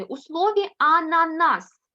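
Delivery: ананас said slowly, every syllable clear; drawing out ананас for the class; teacher spelling out the word; slow stressed word. Shown as a demonstration. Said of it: "де місце букви С у цьому слові".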